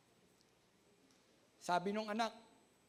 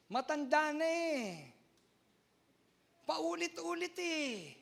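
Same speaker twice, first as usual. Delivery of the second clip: slow, higher, drawn-out; 6 semitones higher, slower than usual. Real, not acted. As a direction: stretch it like a tired elder. Mimicking old repetitive talk.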